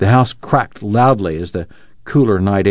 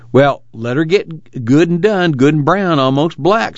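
higher-pitched, irritable retort; 7 semitones higher than usual. A narrator's reading. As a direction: snap it out a little, drawing out the words. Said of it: "said Muley irritably".

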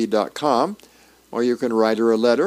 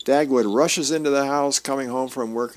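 normal talking voice

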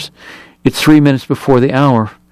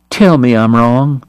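usual speaking voice

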